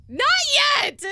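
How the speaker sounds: mock-crying holdout; comic re-enacted sob; retold tearful outburst. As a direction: blurt it as if through tears. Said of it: "So I'm just crying".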